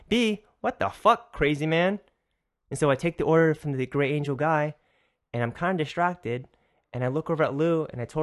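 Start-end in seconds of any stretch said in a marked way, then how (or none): 0.1-2.0 incredulous. Retold or imitated. Incredulous inner reaction.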